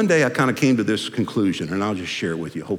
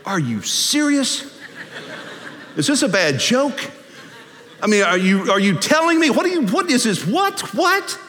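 high, sputtering disbelief; hammed-up dismay; incredulous protest; 13 semitones higher than usual. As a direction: protest in comic outrage, climbing to a squeak. Lazarus appalled at being recalled.

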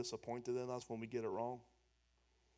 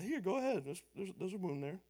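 sarcastic Jesus; 6 semitones higher than usual. Acted. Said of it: "It's like sarcastic Jesus".

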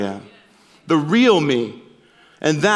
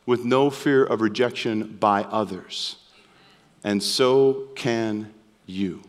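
softer and slower, drawn down low; gentle reassurance; 5 semitones lower, 4 dB quieter, slower than usual. Real, not acted. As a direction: tell them softly they can too. Pastor addresses congregation gently.

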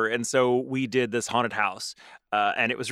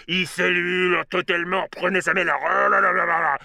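slow, creepy French actor voice; slow spooky voice in real French; weird French voice; 4 semitones higher, slower than usual. Retold or imitated. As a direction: drag it out like a spooky French ghoul. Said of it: "some weird voice being like".

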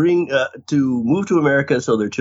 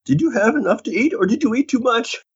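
high-pitched mock worried mom; hammed-up motherly questioning; fussing mother; 13 semitones higher than usual. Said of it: "Typical mother response".

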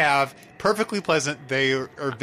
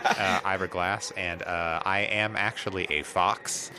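Ira Glass impression; 6 semitones lower than usual. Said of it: "I can't do an Ira Glass".

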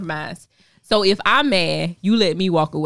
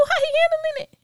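high squeaky question; high mock question; faster than usual. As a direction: ask it way up high. Mimicking the man.

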